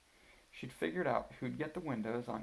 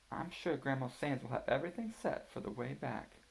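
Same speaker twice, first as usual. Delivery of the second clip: lightly higher voice of a planning mother; Mama; 6 semitones higher than usual. A narrator's reading. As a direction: read it as Mama explaining the trip, a touch higher. Mama continues trip plan.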